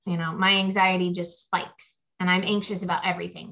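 usual speaking voice

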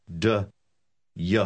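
"D, y" spoken as two separate sounds. Shown as a demonstration. Teacher separates the two sounds of "and you" for learners, slowly. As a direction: split the sounds: D, then y. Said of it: "la combinación de los dos sonidos".

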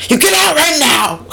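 high mock shout of get out; hammed-up angry boss; mock yell. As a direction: boss barking an order in fun. Biederman throwing the teller out.

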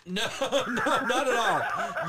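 high laughing no, slow and drawn; real laughter through the words. Real, not acted. Host cracking up at his co-host's joke, laughing.

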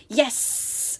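soft, unvoiced serpent hiss; hissing reptile growl. A narrator's reading. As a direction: breathe it out like a snake. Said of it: "a second reptilian voice said".

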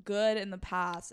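ordinary talk

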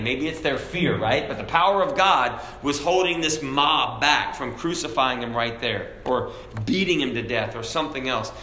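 ordinary talk, nothing marked

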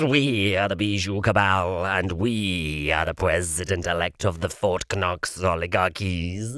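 a droning chorus of insects, low and even; insect swarm drone. A narrator's reading. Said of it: "the insects droned in unison".

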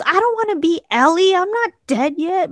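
high-pitched mock wail of protest; comic indignant cry, high and insistent; protesting mother. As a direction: imitate mom objecting, voice pushed way up.

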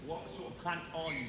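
usual speaking voice

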